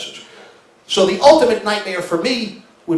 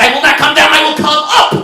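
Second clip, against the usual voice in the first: high, loud angry retort; snapping back at his wife in the car; angry yelling; 8 semitones higher, 6 dB louder than usual. Retold or imitated. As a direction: yell back refusing to calm.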